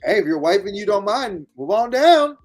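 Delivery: normal talking voice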